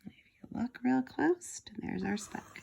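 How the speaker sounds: soft, slow whisper to the class. Real, not acted. Teacher pointing out the speck to the class, whispered. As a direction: lean in and whisper. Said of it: "whisper to class".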